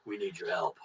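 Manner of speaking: low, hushed mock-dramatic voice; quiet, low-pitched hero impression; character's plea for help. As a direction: drop deep and soft for the plea. Voicing silhouetted characters after the theme.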